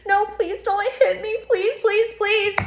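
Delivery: high, pleading please; mock begging. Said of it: "thought I would be begging".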